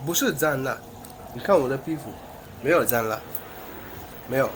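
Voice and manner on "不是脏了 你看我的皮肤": ordinary talk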